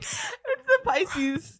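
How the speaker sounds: high, drawn-out mock whimper; mock weepy. Co-host blames being a Pisces for the tears, mock weepy. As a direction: wail it tearfully, half joking. Joking through being emotional.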